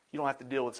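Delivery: ordinary talk, nothing marked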